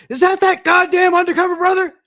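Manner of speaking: high furious bellow, way up and loud; hammed-up furious chief; angry boss yell. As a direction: storm in yelling at the new agent. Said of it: "comes fucking storming out of his office going".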